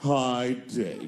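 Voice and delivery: a hint of a cool cat drawl, dropping low; catlike voice. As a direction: say it like a cat who could talk. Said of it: "the way a cat would speak if a cat could talk".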